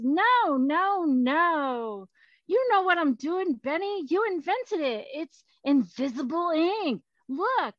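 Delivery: high, sing-song dismissal; animated, breezy storybook ghost; playfully protesting Hancock. Acted. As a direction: brush off the worry with swooping pitch. Plays Hancock reassuring Franklin.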